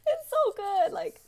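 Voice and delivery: teary, high laughing sighs; fighting back tears with laughs; tearful. Real, not acted. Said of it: "I don't wanna cry".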